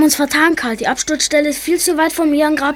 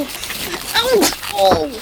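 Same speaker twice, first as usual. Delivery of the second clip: sharp high yelp of pain; pain cry; 7 semitones higher than usual. Acted. Boy trips in the dark moor and cries out in pain. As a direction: cry out as you fall.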